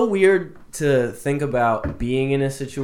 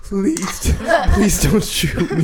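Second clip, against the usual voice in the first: mock begging; 5 semitones higher, much slower than usual. Imagines begging a gunman.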